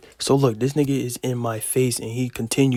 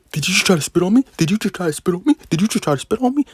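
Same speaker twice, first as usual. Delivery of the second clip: higher, louder mocking voice of an angry kid; mock angry classmate; 7 semitones higher, 4 dB louder, faster than usual. Imitating the classmate yelling at me, higher and mocking. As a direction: repeat it like a riled-up kid in your face.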